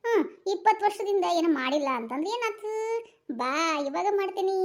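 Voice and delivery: ordinary talk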